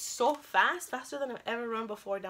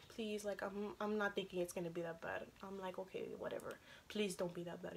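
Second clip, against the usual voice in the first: very soft, retold self-talk; hushed, low hoping; quiet pleading; 3 semitones lower, 12 dB quieter than usual. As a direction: plead under the voice, as if to oneself.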